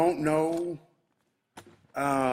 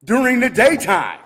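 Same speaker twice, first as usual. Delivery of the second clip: louder and higher, real anger boiling over; livid; 5 semitones higher, 5 dB louder than usual. Real, not acted. Shop owner venting to viewers about the break-in, livid and loud. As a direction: let the fury spill out about the break-in. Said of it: "I am livid right now".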